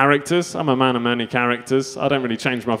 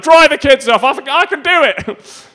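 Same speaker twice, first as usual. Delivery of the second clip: high, loud, urgent yell; frantic shout; 13 semitones higher, 7 dB louder, slower than usual. Acted. Fired-up dad to wife as he runs off, frantic shout. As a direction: shout orders in mock-heroic panic. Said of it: "you're so stressed out".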